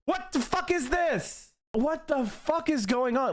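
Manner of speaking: high, exasperated outburst; losing it. Real, not acted. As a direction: wail it in utter disbelief. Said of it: "I legitimately am losing my mind".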